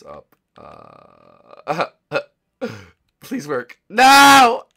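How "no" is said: sudden high yell of no; startled shouted no; loud cry. Real, not acted. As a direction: shout in alarm.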